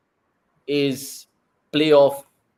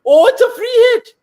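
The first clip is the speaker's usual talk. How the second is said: much higher and louder, the last line slow; excited shout; 11 semitones higher, 10 dB louder, slower than usual. Real, not acted. Fan watching the chase cries out at a free hit. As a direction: yell with sudden hope at the no ball. No ball called during tense chase.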